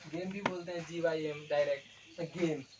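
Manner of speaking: ordinary talk, nothing marked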